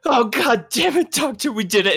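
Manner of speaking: high, loud burst of relief; elated exclamation, voice leaping up; Vajra Blackstaff. Acted. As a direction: cry out that the revival worked. Vajra relieved that Smee revived.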